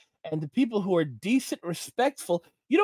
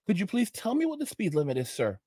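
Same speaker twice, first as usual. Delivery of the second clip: much softer, overly courteous reply; sweetly polite; 8 dB quieter than usual. Retold or imitated. Driver answering the angry trooper, soft mock-polite.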